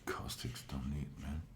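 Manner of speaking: hushed, mostly voiceless echo of himself; whispered aside. Pretend. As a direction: whisper it under your breath as an aside. Whispered echo of own remark.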